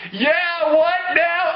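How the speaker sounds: high-pitched, cocky comeback; mock taunt. Villain answers the outraged heroes, mock taunting. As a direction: throw it back as a hammed-up taunt.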